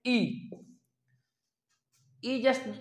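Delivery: ई said like English "e", simple; vowel demo. Shown as a demonstration. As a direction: model ई plainly. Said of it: "जैसे English का कहा जाता है ई".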